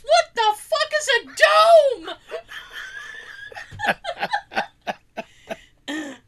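high, loud, incredulous question; mock-exasperated high yell; retold baffled outburst. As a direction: throw it back in loud disbelief. Retold baffled reply in family argument.

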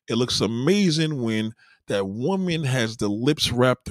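slowed right down, every word spelled out; slow emphatic delivery; slow and deliberate. Acted. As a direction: lay it out slowly so nobody misses it. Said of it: "let me be clear".

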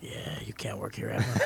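low, near-whispered imitation of a refusal; hushed bad news. Hiring clerk to blacklisted applicant, hushed low refusal. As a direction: drop right down as if reading out a verdict.

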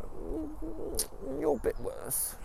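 very high, soft teasing wife; wife's voice. Retold or imitated. Wife teases husband, soft high voice. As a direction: tease him in a tiny high voice.

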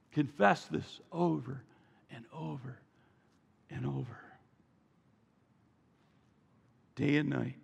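low, soft, fading with each phrase; hushed repetition. Real, not acted. Preacher describes creatures praising over and over, hushed. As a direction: drop right down and let it linger.

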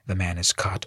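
usual speaking voice

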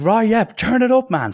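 high, loud, mock-pumped cheer; excited shout-along to the driver; hyped up. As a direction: egg the driver on like a fan. Cheering on the metal music.

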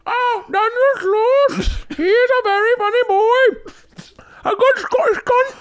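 very high, badly faked Scottish woman; faked female Scottish accent. Friend praising a comic as Scottish chick, squeaky fake accent. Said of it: "do I sound Scottish right now".

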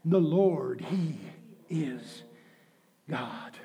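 dropping almost to a whisper; hushed, fading. Acted. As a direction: let each word sink into hush. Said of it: "repeats the line".

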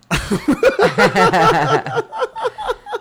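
high, loud burst of laughter; big laugh. Real, not acted. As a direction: crack up at your own joke. Cracking up at own joke.

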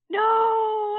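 high gasping no; shocked gasp. Real, not acted. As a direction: gasp then cry out no. Gasps at spoiled goal.

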